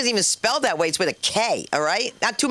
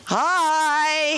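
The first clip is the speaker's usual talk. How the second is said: high, long-held hi; playful shouted greeting; 8 semitones higher than usual. Pretend. Comic points out beige babies, playful high call. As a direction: sing out a big, hammed-up hello.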